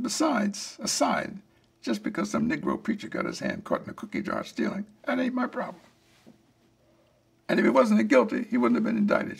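a touch of anger replaying his own curt reply; understated, half-heated defensive anger; retold angry retort. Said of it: "anger at her and angry at the situation".

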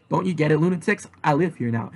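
usual speaking voice

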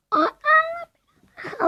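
high, startled "aa"; surprised gasp. Real, not acted. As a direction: yelp at the near miss. Shot nearly goes in.

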